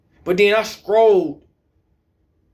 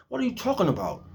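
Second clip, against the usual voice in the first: ordinary delivery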